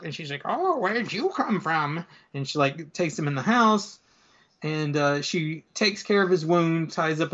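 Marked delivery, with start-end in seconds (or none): 0.4-2.0 put-on high, drawn-out old lady voice; slow, higher-pitched little old woman. Old lady to the wounded burglar, high and slow old-lady voice. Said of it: "he finds this old lady".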